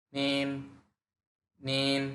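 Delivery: নিন rising upward; নিন with a rising second tone; Mandarin second tone. Shown as a demonstration. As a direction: lift নিন steadily up. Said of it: "উপরের দিকে উঠে গেছে".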